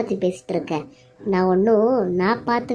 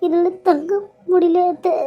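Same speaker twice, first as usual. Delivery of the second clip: higher, tearful breaking plea; crying; 6 semitones higher than usual. Acted. Accused daughter-in-law breaks down before her mother-in-law, crying. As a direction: sob out the words in despair.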